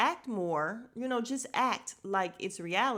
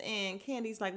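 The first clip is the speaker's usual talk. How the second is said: usual speaking voice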